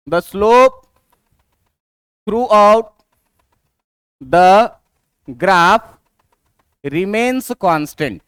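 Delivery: word by word, with long pauses between; slow dictation. Shown as a demonstration. Teacher giving students the rule for straight lines, slow dictation. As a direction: dictate it slowly in small chunks, leaving time to write.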